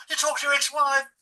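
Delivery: high, loud, disbelieving question; shocked question. Retold or imitated. Shocked friends asking him about his ex-wife, high and loud.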